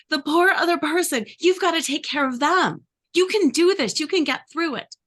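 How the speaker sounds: high, loud, over-the-top peppy cheering; pushy cheerleader. Acted. Inner cheerleader urging you to skip sleep, high and loud. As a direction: toxic positivity, cheer them past their limits. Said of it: "the cheerleader is the voice that is really encouraging".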